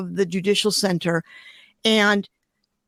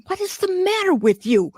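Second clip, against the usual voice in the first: pitched up sharply, scolding herself; self-scolding inner voice; 9 semitones higher than usual. Retold or imitated. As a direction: snap at yourself in your head.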